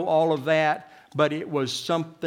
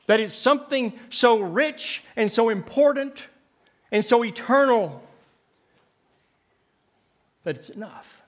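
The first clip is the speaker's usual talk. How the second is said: ordinary delivery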